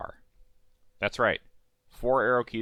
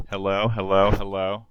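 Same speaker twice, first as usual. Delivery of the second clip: mic check; 5 dB louder, slower than usual. Real, not acted. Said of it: "How's this audio sounding?".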